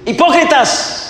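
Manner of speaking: high, loud shout of accusation; shouted rebuke. Acted. Jesus shouts at the scheming Pharisees, high and loud. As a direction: hurl the word at them like Jesus rebuking. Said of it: "está diciendo Jesús le gritó".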